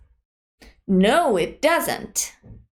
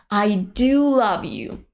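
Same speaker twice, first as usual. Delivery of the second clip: slow, "do" clearly stressed. Shown as a demonstration. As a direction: press "do" so they believe you. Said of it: "Notice that we stress the verb do".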